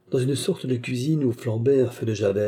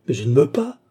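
higher, louder cry of despair; sudden despair; 5 semitones higher, 6 dB louder than usual. A narrator's reading. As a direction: protest in sudden desperation. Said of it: "avec un subit désespoir". (cri de désespoir subit)

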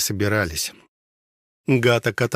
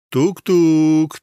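high, teasing mysterious knock-knock; 6 semitones higher, 4 dB louder than usual. A narrator's reading. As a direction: tease it like a sly riddle. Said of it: "таинственным голосом произнёс Бор". (таинственным голосом)

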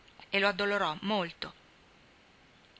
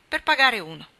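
blurted answer; 3 semitones higher, 8 dB louder than usual. A narrator's reading. Boy questioned at dinner.

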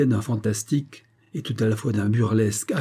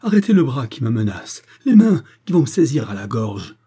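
loud, frightened plea; desperate pleading; 3 semitones higher, 7 dB louder than usual. A narrator's reading. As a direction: beg to be saved from an attacker. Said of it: "supplia Harry Madge".

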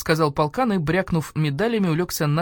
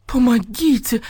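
high, loud begging cry; imploring wail; desperate plea; 7 semitones higher, 5 dB louder, slower than usual. A narrator's reading. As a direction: beg for rescue. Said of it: "взмолилась Нина Павловна".

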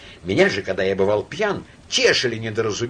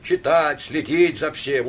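ordinary delivery, nothing marked

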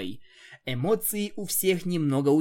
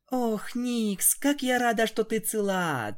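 almost sobbing; 9 semitones higher than usual. Acted. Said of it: "Твайлайт едва не рыдала".

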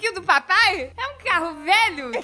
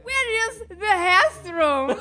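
put-on slow, higher Brazilian-accented English; dad's Brazilian English; 4 semitones higher, much slower than usual. Dad reciting his few phrases, slow Brazilian-accented English. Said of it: "Aí ele sabia também".

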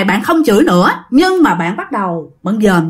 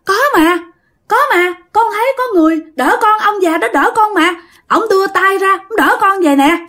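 put-on child insisting; 8 semitones higher than usual. Child insisting an old man caught her.

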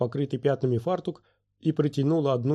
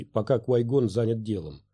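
usual speaking voice

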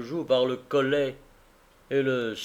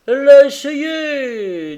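higher, louder, slow outburst of feeling; with emotion; 12 semitones higher, 12 dB louder, slower than usual. Acted. Carrier defends his wife to Tackleton, moved and loud. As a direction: let the emotion break through. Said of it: "avec plus d'émotion".

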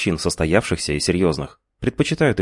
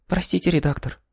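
put-on high, hoarse girl's voice; сиплый женский голос; 12 semitones higher than usual. A narrator's reading. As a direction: voice her small and hoarse. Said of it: "Её голос прозвучал сипло". (сиплый высокий голос)

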